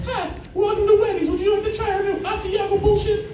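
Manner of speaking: very high, squeaky mock girl voice; mock cashier greeting in high falsetto; little drive-thru girl. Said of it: "the poor little girl at Wendy's like".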